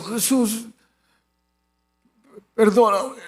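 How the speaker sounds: faint, halting imitation of the injured man; injured man praying. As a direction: struggle to get the prayer out. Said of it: "Y empezó". (oración débil y entrecortada de herido)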